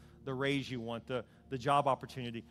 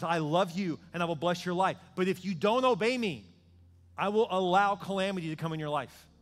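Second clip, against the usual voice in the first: louder, firm voice of God; God's warning; 4 semitones higher, 6 dB louder than usual. Acted. Preacher voicing God warning the disobedient, firm. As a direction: warn with loving firmness. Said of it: "God says".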